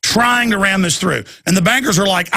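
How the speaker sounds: ordinary talk, nothing marked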